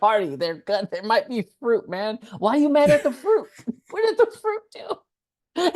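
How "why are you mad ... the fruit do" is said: high, laughing mock outrage; amused disbelief. Real, not acted. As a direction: pretend outrage, cracking up. Mocking mad character.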